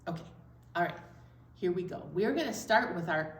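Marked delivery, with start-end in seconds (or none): none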